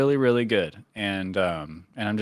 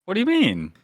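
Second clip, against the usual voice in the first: amazed disbelief; 8 semitones higher than usual. Real, not acted. Shocked at pinned notes.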